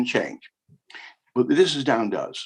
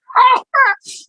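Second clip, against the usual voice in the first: real laugh; 8 dB louder than usual. Real, not acted. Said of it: "Look, I haven't done this for a while".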